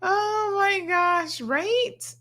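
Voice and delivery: high laugh running into slow words. Real, not acted. Host cracks up at a viewer's comment, high and slow. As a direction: laugh high, then drawl it out amused. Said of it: "laughing at viewer comment".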